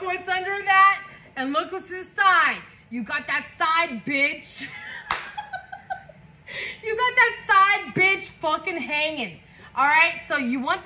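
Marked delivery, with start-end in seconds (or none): 4.7-6.0 high, soft giggle; high laugh. Real, not acted. Reader giggles at the side bitch card.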